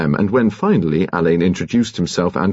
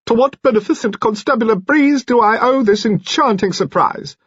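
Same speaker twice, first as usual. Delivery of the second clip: fluting alto. A narrator's reading. Mister Finn mocking the detectives in his alto. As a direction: relish every grand word. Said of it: "Mister Finn fluted thickly".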